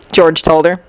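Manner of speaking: usual speaking voice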